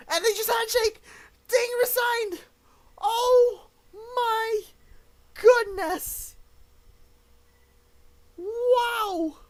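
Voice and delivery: very high, loud yells of disbelief; shocked screaming. Real, not acted. Commentator sees Ding resign live, shocked screaming. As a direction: shout it in real astonishment, words stretched.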